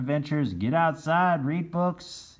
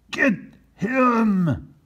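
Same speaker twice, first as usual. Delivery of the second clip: high loud command; shouted order; 12 semitones higher, 6 dB louder than usual. Acted. Captain Bill ordering his men to chase. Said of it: "he shouted".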